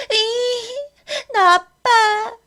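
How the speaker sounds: high, slow, tearful wail; crying. Acted. Younger girl crying at Hari, slow tearful wail. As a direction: wail it out through tears.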